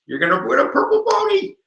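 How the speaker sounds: very high, squeaky promise; silly prize tease. Pretend. Teacher bribing viewers with a silly prize, squeaky.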